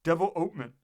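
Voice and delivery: put-on "Development" repeated firmly with the accent on "ope"; emphatic, stress on OPE. A narrator's reading. President ignoring the correction, emphatic "Development" stressed on "ope". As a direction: insist on "Development", weight on the "ope". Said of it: "again said".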